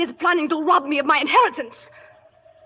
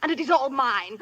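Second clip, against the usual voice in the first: ordinary delivery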